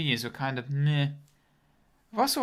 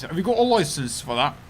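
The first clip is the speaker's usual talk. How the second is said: put-on louder British accent with rising question; 2 semitones higher, 6 dB louder than usual. Streamer redoing the licence question, put-on British accent. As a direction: challenge them in a hammed-up British accent. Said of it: "I'm not very good at accents".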